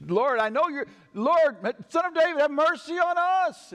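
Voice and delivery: loud, very high desperate call; crying out. Acted. Blind men calling after Jesus unseen, crying out loudly. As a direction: shout it over a crowd, stretching the last words. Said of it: "cried out".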